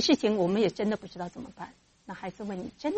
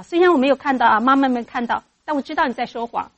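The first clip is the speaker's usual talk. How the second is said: accusing parent; 4 semitones higher, 10 dB louder than usual. Acted. Parent accuses child of lying.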